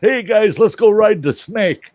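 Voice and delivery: high, loud toad rallying the guys; tipsy toad. Acted. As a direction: holler a daft dare to drinking buddies. Tipsy toad proposes a stunt.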